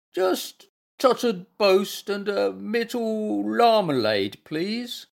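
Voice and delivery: high, slow invalid voice; pitiful pleading. A narrator's reading. Bear pretending illness wants breakfast, high pitiful plea. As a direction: plead slowly as a poorly bear.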